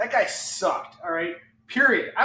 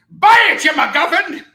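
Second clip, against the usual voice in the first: high, loud playful yell; laughing shout; 10 semitones higher, 14 dB louder than usual. Pretend. Seller jokingly yelling at viewers to buy. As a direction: bark it at them laughing.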